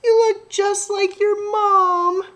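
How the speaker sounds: high, tearful, tender voice; tender, moved child. Acted. Sophie tenderly to baby squash.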